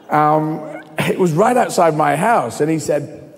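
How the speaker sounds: ordinary talk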